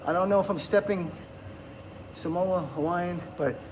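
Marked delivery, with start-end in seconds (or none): none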